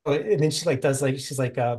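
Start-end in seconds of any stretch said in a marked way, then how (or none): none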